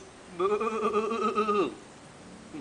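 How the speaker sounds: long, high quavering baa; imitated sheep cry; shouted sheep bleat. A narrator's reading. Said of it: "gritó".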